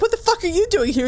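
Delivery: high, quick startled snap. Retold or imitated. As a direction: caught off guard, fire it out. Acted startled quarrel.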